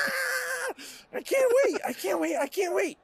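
very high, squealed repetition; manic excitement. Acted. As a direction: burst with unhinged anticipation. Ragmek's frenzied glee, acted.

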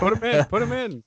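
high, eager plea; excited. Acted. Joking plea, both high.

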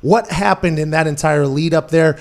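ordinary talk

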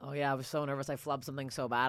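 ordinary talk

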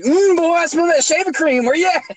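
high-pitched mock trash talk; Daniel. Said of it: "it was all the shit talking".

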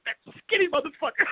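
high, disbelieving aside; amazed mutter. Retold or imitated. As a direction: marvel at how skinny he is. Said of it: "I'm like".

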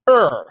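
a strong American R sound, er; rhotic er. Shown as a demonstration. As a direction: pull the R right back in er. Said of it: "meaning we go".

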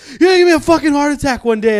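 fast, loud, high-pitched scolding, imitated; mock freaking out. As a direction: play the startled man telling someone off. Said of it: "he'd just be freaking out".

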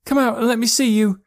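high, loud demand; frightened boy's yelled order; yelling. A narrator's reading. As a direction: shout it high at someone hiding. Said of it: "yelled Joe".

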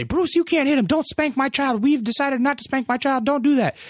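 high imitated mother defending her child; mom scolding. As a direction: protective mother telling him off, high. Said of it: "And they say".

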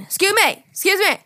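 high, loud, pushy imitation; pushy stranger. Retelling the rude Walmart guy, pushy high voice. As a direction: mimic a rude guy calling out.

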